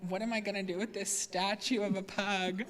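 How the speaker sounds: soft, laughing bewilderment; quietly chuckling at a silly gift; amused puzzlement. Retold or imitated.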